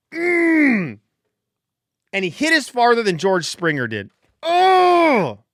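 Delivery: high, loud delighted yelp; joyful squeal. Real, not acted. Fan squealing at the homer live, joyful. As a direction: let out a thrilled shriek. Screaming with joy.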